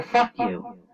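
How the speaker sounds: sudden high, defiant snap; angry outburst. Real, not acted. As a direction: spit it back at the critics. Angry retort to critics.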